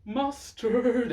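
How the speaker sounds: high, loud rating call; excited announcement. Real, not acted. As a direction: belt out the verdict.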